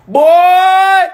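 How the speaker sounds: high, loud drawn-out cry; exasperated shout. Acted. Comic scolding a rapper, exasperated shout. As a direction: belt it out in exasperation.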